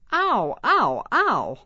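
high, loud playful ouch; hammed-up ow for kids; mock pain. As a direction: yelp as if bitten. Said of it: "Hold arm like it's hurting".

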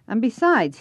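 mocking mimic. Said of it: "he mimicked me".

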